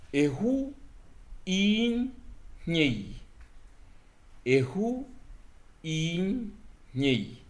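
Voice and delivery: "ehu, in, nyei" high and loud, word by word; example model. Shown as a demonstration. Teacher models the example "ehu in nyei" twice to students. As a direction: spell out "ehu, in, nyei" slowly and clearly.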